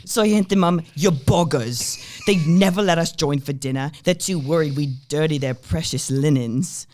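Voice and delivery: a cook scoffing at the mistress, loud cockney; cockney accent. Acted. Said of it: "the accent I'm going to do".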